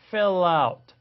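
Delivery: "fill out" slow, joined as fil-lout; linking demo. Shown as a demonstration. Teacher drawing attention to the link, "fill out" joined. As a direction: slowly carry the L of "fill" into "out". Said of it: "요게 주목하셔서".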